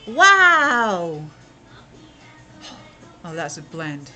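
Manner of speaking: ordinary talk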